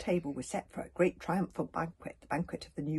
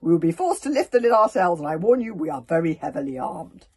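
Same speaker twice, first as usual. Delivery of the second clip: I-must-be-obeyed voice; 11 dB louder than usual. Acted. Stoic warns the cauldron in his most important voice. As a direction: boom it out to be obeyed. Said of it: "I must be obeyed voice".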